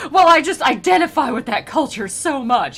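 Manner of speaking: mock wounded superfan; defensive fanboy. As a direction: protest earnestly, hammed up. Fan's acted defensive reply.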